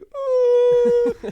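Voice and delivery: long, high, loud howl; mock grief wail. Son reading his dead mother's letter, mock wail. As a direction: howl in hammed-up anguish.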